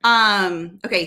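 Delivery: normal talking voice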